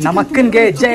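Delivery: high-pitched rallying cheer. Real, not acted. Vlogger hails elder sister at lunch, cheering. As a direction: cheer it out like a slogan.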